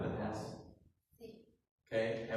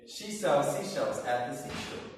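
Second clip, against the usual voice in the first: fastest; 4 dB louder than usual. Shown as a demonstration. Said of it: "even faster".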